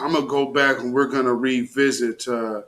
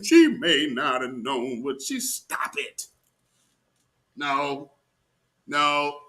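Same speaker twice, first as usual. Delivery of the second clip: usual speaking voice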